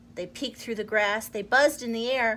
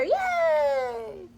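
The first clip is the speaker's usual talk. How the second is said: much higher excited cheer. Acted. Astonished creatures cheering the mouse, high cheer. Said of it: "began to cheer".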